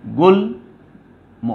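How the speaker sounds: গুল্ম split into "gul", long pause, "mo"; syllable by syllable. Shown as a demonstration. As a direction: dictate গুল্ম slowly in two separate parts. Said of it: "আমরা তাইলে লিখব".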